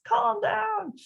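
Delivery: hammed-up frazzled parent yelling; mock yelled calm down. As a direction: shriek it like an exasperated parent. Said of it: "You could hear it in my voice".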